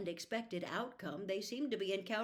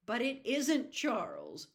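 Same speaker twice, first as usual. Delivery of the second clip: louder, slow anguished cry; cried in anguish; 5 semitones higher, 7 dB louder, slower than usual. Acted. Meg to her father, a slow anguished cry. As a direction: beg him to understand. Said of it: "Meg cried in anguish".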